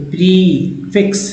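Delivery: slow "prefix", prefix held apart; "pre", then "fix", drawn out; split into parts. Shown as a demonstration. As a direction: sound out "prefix" in two chunks. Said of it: "pre बोलते हैं".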